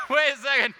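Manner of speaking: loud and high, laughing through it; laughing wait. Real, not acted. Host to audience, laughing at his own wrong guess. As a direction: crack up while stopping them.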